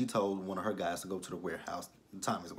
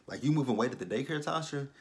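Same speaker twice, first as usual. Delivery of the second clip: quick, higher-pitched mock accusation; retold angry challenge, pitched up on the name; pissed off; 6 semitones higher, 3 dB louder than usual. Angry man confronts woman about the daycare, mildly acted. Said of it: "He is pissed off".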